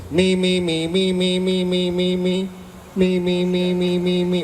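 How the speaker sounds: one held note, repeated without variation; monotone rapid list. Retold or imitated. Boyfriend scrolling texts all from himself, monotone repetition. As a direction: drone each word on the same pitch.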